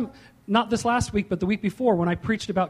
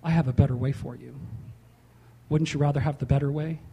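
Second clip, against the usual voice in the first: gentle conviction; 6 semitones lower than usual. Acted. Preacher voicing conviction, gently coaxing. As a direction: invite them kindly toward the better way. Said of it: "Conviction says".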